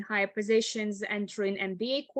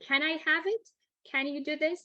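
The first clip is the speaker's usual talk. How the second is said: "Can I have it?" and "Can you do this?" lifted high at the end; question intonation; 5 semitones higher, faster than usual. Shown as a demonstration. Teacher showing how to ask for something, rising question intonation. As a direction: ask "have it" and "this" with rising pitch, not as demands. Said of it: "make it a question".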